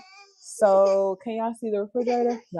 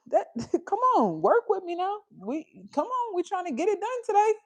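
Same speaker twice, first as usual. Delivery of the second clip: pleading; 10 semitones higher, 3 dB quieter than usual. Real, not acted. Pleading with fussy toddler.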